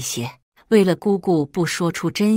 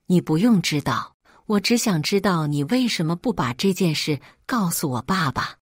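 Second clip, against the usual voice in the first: ordinary delivery, nothing marked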